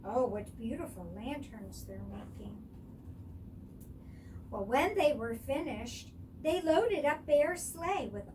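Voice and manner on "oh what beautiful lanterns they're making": plain reading voice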